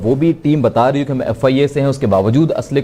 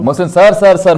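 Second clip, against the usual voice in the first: louder and higher, calling over a bad line; 7 semitones higher, 5 dB louder than usual. Real, not acted. Host calling out to his guest on a bad line, loud and raised. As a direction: raise your voice to reach him on the call.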